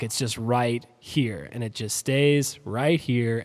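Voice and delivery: slow, level-pitched imitation of monotone talk. Retelling feedback on how the hosts talk, imitated monotone. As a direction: keep it slowly stuck on one note. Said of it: "You're very monotone".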